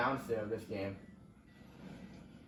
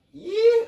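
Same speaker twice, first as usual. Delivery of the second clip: sudden yelp; 15 dB louder than usual. Real, not acted. Yelps as kart swerves.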